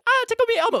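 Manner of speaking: high squeaky toy voice; talking Elmo toy. Retold or imitated. Storyteller recalling what the chair could do, high toy voice. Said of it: "It did all these things".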